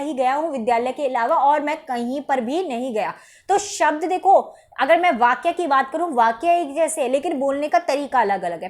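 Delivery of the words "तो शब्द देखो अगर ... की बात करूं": usual speaking voice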